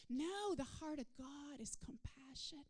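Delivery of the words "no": ordinary delivery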